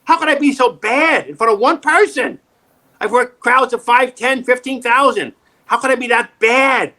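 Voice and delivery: retelling frustration to a friend, in a high exasperated voice; exasperated self-quote. Retold or imitated. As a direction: replay your own despair after a bad audition. Retold despairing self-quote.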